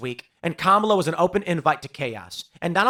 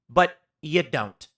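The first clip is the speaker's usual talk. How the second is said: normal talking voice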